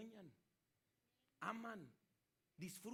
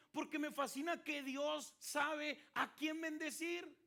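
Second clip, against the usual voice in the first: slow, louder, every word weighed; fervent sermon delivery; emphatic preaching; 7 semitones higher, 7 dB louder, much slower than usual. Acted. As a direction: lean into each word from the pulpit. Preaching at full force.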